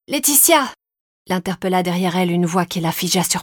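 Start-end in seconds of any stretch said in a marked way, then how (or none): 0.1-0.7 high-pitched hail by name; calling out. A narrator's reading. Woman hailing neighbour from behind, calling out. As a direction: call to catch someone's attention.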